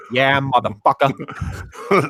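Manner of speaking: slow, drawn-out Boston tough guy; laughing Boston accent. Retold or imitated. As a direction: lay on a thick Boston accent, slowly. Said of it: "somebody from Boston be like".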